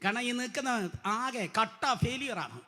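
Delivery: hushed, meek mock of timid preachers. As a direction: mumble it like a sheepish excuse. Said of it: "ഇന്നത്തെ ഉപദേശിമാർ പറയും".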